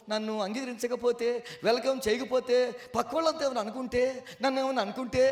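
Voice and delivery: ordinary talk, nothing marked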